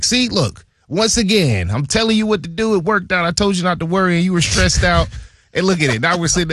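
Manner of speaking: high, playful impression of the GM gloating; high mock-GM voice, I told you so; Colbert bragging. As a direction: tease him like a proud boss who was right all along.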